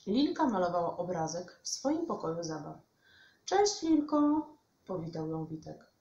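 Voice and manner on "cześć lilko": high, loud, slow hello; singsong greeting. A narrator's reading. Witek arrives at Lilka's playroom, calling out. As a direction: call out a drawn-out hello.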